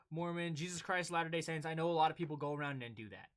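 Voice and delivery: normal talking voice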